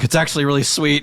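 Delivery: pleased discovery; surprised Dave. Acted. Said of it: "he's like".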